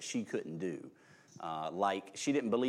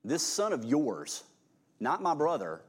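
slow, sneering and a bit higher; contemptuous; 5 semitones higher, 3 dB louder than usual. Acted. The resentful older son complaining to his father, with contempt. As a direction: spit out the words as if disowning a brother. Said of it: "can you just hear the contempt in his voice".

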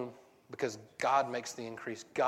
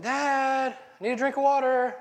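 put-on much higher, louder pleading kid; whiny kid plea; 10 semitones higher, 5 dB louder than usual. Kid begs dad for water, high whiny call. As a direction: whine up at dad from bed. Kid yelling third time.

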